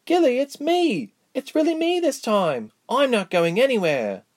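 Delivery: very high, drawn-out joyful insistence; elated reunion voice, pitched high; emotional reassurance. Acted. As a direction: convince her it's really you, high and excited. Reassures sobbing friend at reunion.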